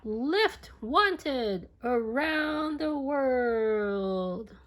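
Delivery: slow, high, spelled-out message; slow sign reading. A narrator's reading. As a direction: read it out like a written notice.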